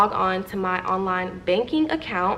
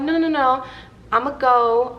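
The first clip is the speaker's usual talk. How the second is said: quick higher, louder no's; firm refusal; 5 semitones higher, 4 dB louder than usual. Retold or imitated. Turning down Coco's offer to come outside, firm and loud. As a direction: brush her off firmly.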